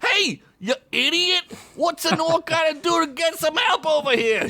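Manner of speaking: high, strained orc bellow; gruff orc shouting. Acted. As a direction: yell at the goblin across the warehouse. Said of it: "shouted Blimp".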